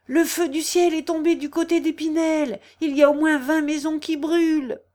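high, loud, panicky news; shouted alarm. A narrator's reading. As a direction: cry out the disaster to everyone. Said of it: "criait Claudie".

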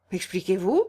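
cool, guarded demand; a touch of frost; coldly. A narrator's reading. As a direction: ask for an explanation with cool reserve. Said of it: "dit froidement Guillaume".

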